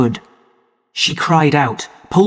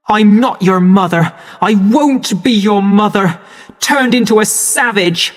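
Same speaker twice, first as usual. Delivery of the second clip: higher, louder bitter yell; furious shout; 6 semitones higher, 3 dB louder than usual. A narrator's reading. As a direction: lash out at the boy. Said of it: "she shouted".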